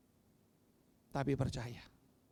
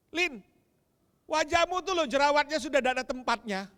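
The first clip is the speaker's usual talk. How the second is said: cruel remark mimicked; 9 semitones higher, 8 dB louder than usual. Congregant's cruel remark retold.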